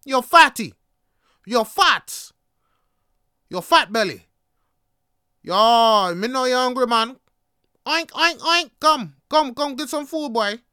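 loud, high jeering at a hungry man; mocking taunt. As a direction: heckle him, imitating a loud bully. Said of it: "he's insulting me".

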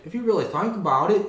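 loud, quick imitated Australian; Australian accent. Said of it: "he's Australian".

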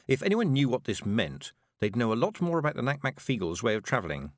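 plain reading voice

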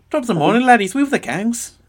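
high, hammed-up Irish accent. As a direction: ham up a bad Irish brogue. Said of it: "I can't do an Irish accent very well".

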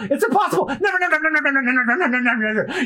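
high, frantic repeated nevers; a scared cabin boy rattling off never; panicked Kobe. Acted. Cabin boy Kobe frantically refusing Luffy's pirate dream, high and panicky.

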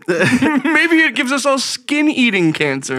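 high, loud, stretched-out speculation; mocking clueless guess. Mocking a president's hedge, high and drawn-out. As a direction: pitch it up, mock not knowing. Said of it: "You're just like".

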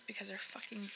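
hushed whisper on the swear. Real, not acted. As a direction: whisper it under your breath. Whispering a swear word on mic.

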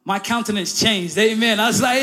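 normal talking voice

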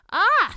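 sharp high yelp of disgust; disgusted cry. Acted. Girl recoiling from ants, shrill disgusted cry. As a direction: squeal as ants run over her fingers. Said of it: "she cried in disgust".

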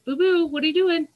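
pet talk. Real, not acted. Owner calls to her pet Boo Boo in a high cooing voice.

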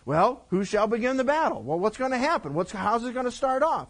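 lightly voiced king, eager and demanding; insistent king. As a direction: keep pushing the prophet for details. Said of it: "Then he said".

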